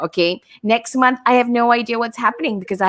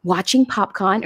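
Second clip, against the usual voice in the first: ordinary talk, nothing marked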